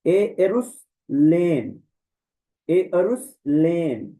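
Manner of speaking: very slow एरुसलेम, e clearly first; एरुसलेम, begin on a clear e; corrected pronunciation. Shown as a demonstration. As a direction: model the fixed एरुसलेम. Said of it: "sorry".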